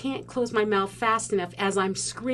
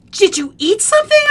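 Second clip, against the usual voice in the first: loud, high-pitched question; raised voice, acted urgency; urgent brother; 11 semitones higher, 10 dB louder than usual. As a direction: shout the question, imitating an alarmed brother. Brother questioning crying child.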